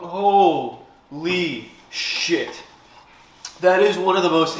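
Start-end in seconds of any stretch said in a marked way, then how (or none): 0.1-2.6 drawn-out. Real, not acted. Recovering from burn.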